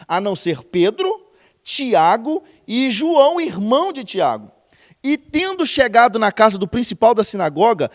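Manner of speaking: loud, slow Bible reading; preaching scripture reading. Acted. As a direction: declaim the passage to the congregation. Reads scripture at full force.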